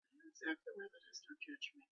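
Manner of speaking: embarrassed mumble; sheepish. Retold or imitated. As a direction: hush it like a guilty confession. Embarrassed aside about baby team.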